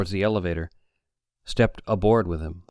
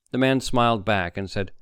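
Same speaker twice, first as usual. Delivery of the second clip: ordinary delivery, nothing marked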